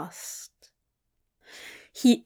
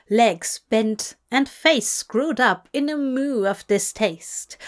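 usual speaking voice

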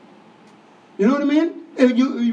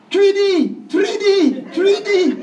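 higher, louder call of three D; calling out from the sidelines; 8 semitones higher, 4 dB louder, slower than usual. Retold or imitated. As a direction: shout it across the court to his son. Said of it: "So I'd be shouting out to him".

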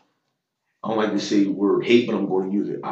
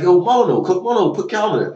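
senior urging coach; 10 semitones higher, 4 dB louder than usual. Retold or imitated.

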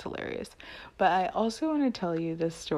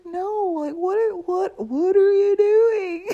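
loud, high-pitched mock outburst; acted incredulous yell; exasperated husband; 13 semitones higher, 10 dB louder than usual. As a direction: blow up in baffled exasperation, stretching the end. Exasperated husband in retold quarrel.

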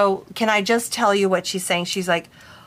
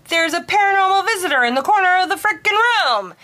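blunt freaked-out blurt; 9 semitones higher, 3 dB louder, faster than usual. Pretend. Blurting the news to client.

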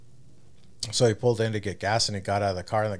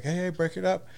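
calling out; 8 semitones higher than usual. Retold or imitated. Bystanders breaking up fight.

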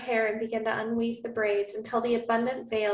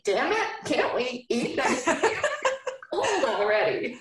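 breaking into laughter mid-line; laughing through a mock gripe; mock hero laughing; 4 semitones higher than usual. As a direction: giggle through his complaint. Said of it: "He's like".

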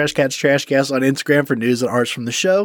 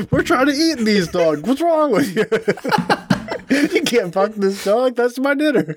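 high put-on voice; 10 semitones higher than usual. Acting out a joke scene.

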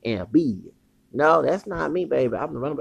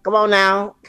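loud, high come on now; challenging; 7 semitones higher, 6 dB louder than usual. Real, not acted. Man daring a rival near his woman, loud and high. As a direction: call him out, come on now.

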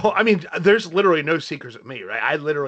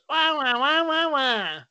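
muffled wah wah talk; 9 semitones higher, much slower than usual. Retold or imitated. Caillou crying sounds.

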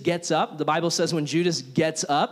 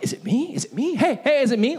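panicked; 7 semitones higher than usual. Retold or imitated. Disciples panicking at the table.

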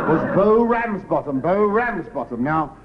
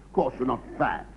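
ordinary delivery

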